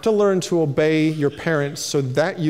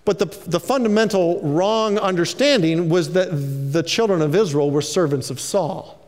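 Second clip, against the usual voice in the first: ordinary talk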